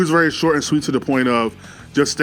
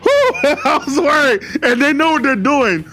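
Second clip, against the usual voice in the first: laughing; 10 semitones higher than usual. Real, not acted. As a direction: laugh with relief while talking.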